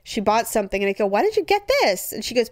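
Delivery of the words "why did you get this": put-on child voice, much higher; high whiny kid. Kid questioning mom.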